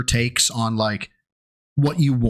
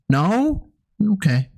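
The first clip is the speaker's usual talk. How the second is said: normal talking voice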